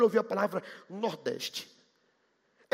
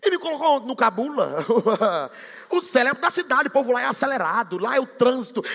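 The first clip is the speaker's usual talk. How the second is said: ordinary talk, nothing marked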